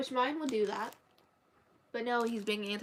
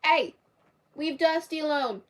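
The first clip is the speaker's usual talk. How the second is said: loud, high order to a pet; scolding shout; 7 semitones higher, 9 dB louder than usual. Real, not acted. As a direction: call out sharply to stop the cat. Breaking up a cat fight.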